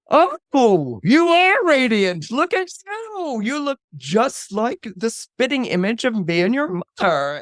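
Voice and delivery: high, drawn-out rapturous cooing; overjoyed father, swooping high; gushing delight. Acted. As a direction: gush over a long-lost son. Father delighted to meet his son.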